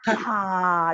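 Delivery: ha with a puff of breath; teacher demo of breathy ha; aspirated puff. Shown as a demonstration. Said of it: "you put the puff or wind puff".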